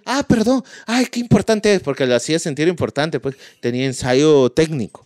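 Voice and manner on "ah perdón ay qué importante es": mock girlfriend. Voicing the girlfriend.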